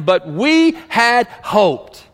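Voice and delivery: each word stretched slowly, high and loud; drawn out for weight. Acted. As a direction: let every word of "we had hoped" land heavily. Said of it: "Let that sink in for just a moment".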